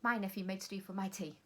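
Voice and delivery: fast read-through; quick, brisk reading of the sentence; really quickly. Shown as a demonstration. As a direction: rattle it off quickly. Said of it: "can you read it really quickly".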